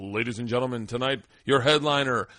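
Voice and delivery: loud announcer intro, stretching the name; club announcer. Retold or imitated. Sound-booth guy introducing the headliner at the bar mic, booming announcer.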